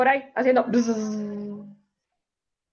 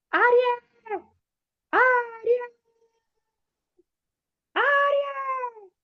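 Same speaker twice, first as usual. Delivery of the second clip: much higher calls of a parrot from a branch; parrot calling; 10 semitones higher, slower than usual. Acted. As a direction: squawk the name out like a parrot. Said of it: "que gritó desde su rama".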